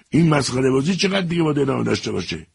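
high, fast, exasperated; choking with rage. Acted. Furious major fed up with the soldier, fast angry outburst. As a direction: spit it out barely holding anger.

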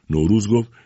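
usual speaking voice

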